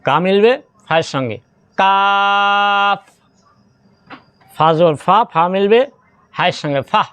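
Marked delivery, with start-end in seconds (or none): 1.8-3.0 কাফ stretched very slowly in chant; recited কাফ with a long held vowel; elongated recitation. Shown as a demonstration. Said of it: "চার আলিফ টানতে হবে".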